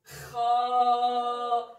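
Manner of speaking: Kha loud and emphatic from the throat; "Kha" emphatique; heavy kha. Shown as a demonstration. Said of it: "C'est plus grave".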